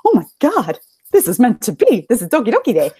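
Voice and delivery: gushing that the sake is meant to be; excited gush. Retold or imitated. Friend at the izakaya sees a sake named Doki Doki, excited. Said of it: "I was like".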